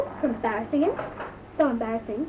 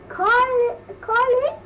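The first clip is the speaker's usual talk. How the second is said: high loud yell of a name; shouting a name; 12 semitones higher, 5 dB louder, slower than usual. Retold or imitated. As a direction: call her name, much higher and louder. Brother calling her name loudly.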